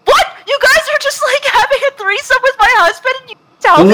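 high, fast scandalized outrage; hammed-up outraged spouse; mock outrage. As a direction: play the betrayed wife, shrieking.

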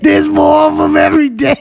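excited shout. Real, not acted. Excited praise of the show.

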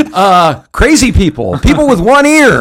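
loud, high, desperate; frantic guessing. Retold or imitated. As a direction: throw wild guesses out in panic. Frantic guessing under the clock.

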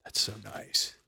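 soft breathy whisper into the mic. Real, not acted. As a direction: whisper close to the mic, delighted. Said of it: "Can you hear me whispering into the mic".

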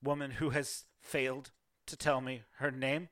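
a widow holding herself together, barely; grieving half-elf noblewoman. Acted. Half-elf widow telling the visitor of the night's horrors, bitter grief.

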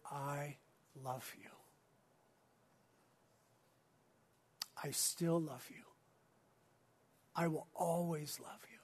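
soft, unhurried declaration of love; hushed, loving tenderness; tender compassion. Acted. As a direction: gently reassure someone who has failed you. Voices Christ's compassionate gaze at Peter.

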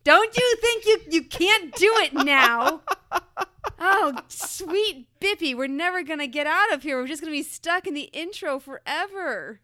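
high, loud, laughing protest; laughing exasperation. Real, not acted. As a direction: shriek it through laughter.

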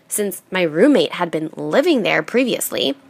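ordinary delivery, nothing marked

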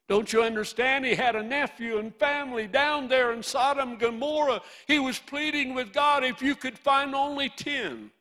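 high, loud pleading cry; impassioned preaching. Real, not acted. Preacher describing Abraham pleading with God for Lot, impassioned. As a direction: plead at the top of your voice.

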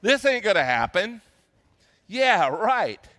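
loud, scoffing "yeah, right"; mock incredulity in everyday vernacular; cynical disbelief. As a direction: imitate a scornful doubter, punched out loud. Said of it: "her laughter was a cynical laughter".

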